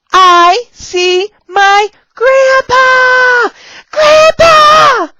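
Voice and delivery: very high, loud, drawn-out cheer; exaggerated teaching excitement. Shown as a demonstration. Teacher showing the grandpa puppet, high excited shout. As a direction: shout it slowly for a small child.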